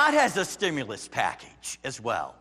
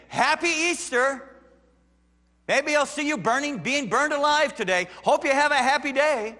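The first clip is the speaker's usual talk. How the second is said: mock cheery greeting; 10 semitones higher, 6 dB louder than usual. Preacher mocking a cheery Easter greeting to the persecuted. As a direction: sarcastically chirp a holiday greeting.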